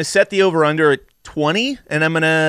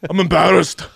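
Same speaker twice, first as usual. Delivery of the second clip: Fitzy; 3 semitones higher than usual. Retold or imitated. Host joins in mimicking the film's drunk guy, hammed-up brogue. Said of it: "sounds like an old drunk guy".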